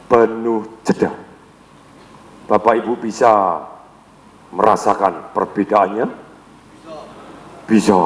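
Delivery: slow, heavy, full of pauses. Shown as a demonstration. Trainer demoing the feeling type, low slow pause-filled voice. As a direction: sink the voice and let every word land. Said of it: "dia ngomongnya berat, nadanya rendah, dengan penuh tekanan dan jeda".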